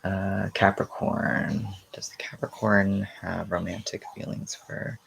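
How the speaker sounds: hushed whisper to the pendulum. Real, not acted. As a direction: whisper the question softly. Asking the pendulum privately.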